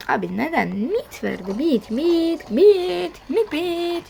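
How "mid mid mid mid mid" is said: loud, high repeated calls; frantic callout. Real, not acted. Gamer calling out his lane in a frantic chant. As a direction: hammer the word over and over.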